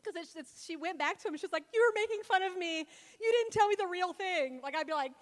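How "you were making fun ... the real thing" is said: higher, louder put-on pouting; Delilah's mock complaint. Woman accusing Samson of making fun of her, mock pouting. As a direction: drag out a mock-wounded complaint.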